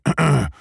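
short awkward throat clear. A narrator's reading. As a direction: clear the throat before speaking. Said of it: "and cleared his throat".

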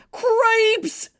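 high squeaky mouse cry; squeaky mouse exclaim. Acted. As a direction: yelp it like a startled mouse. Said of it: "said Furlow".

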